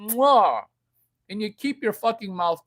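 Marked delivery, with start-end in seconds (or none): 0.1-0.6 loud smacking air kiss; kiss sound. Shown as a demonstration. Showing how to kiss a dog's face, loud mwah. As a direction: give a big smooch sound. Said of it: "This is how to kiss a dog's face".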